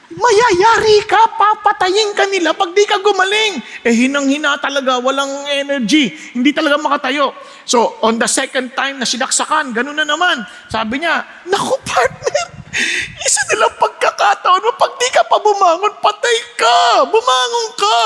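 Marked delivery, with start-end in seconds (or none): 0.1-3.6 urgent warning. Acted. Pig warning the horse. 11.5-18.0 desperate pig. Acted. Pig begging the dying horse, high shouted plea.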